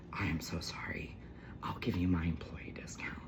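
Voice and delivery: whispered, almost no voice; hushed apology. Retold or imitated. Store clerk whispering sorry to Maddie, hushed apology. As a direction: breathe it out like a secret apology.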